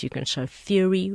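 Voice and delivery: ordinary talk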